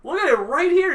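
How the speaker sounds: high, loud mock argument; hammed-up yell of disbelief; acted exasperation. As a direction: insist loudly that a friend look. Acted quarrel over unseen nutcracker.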